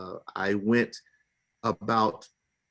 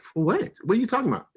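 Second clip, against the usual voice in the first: shocked disbelief; 10 semitones higher, 4 dB louder, faster than usual. Retold or imitated. Client hearing of arrest warrant, shocked and higher-pitched. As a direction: pitch climbing in baffled protest.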